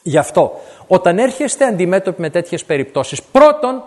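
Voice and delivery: ordinary talk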